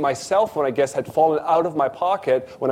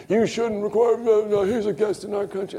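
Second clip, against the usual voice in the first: higher-pitched retold yell at the driver; mock angry yelling; 7 semitones higher than usual. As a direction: play the friend scolding, pitched up. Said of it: "he starts screaming at this taxi driver".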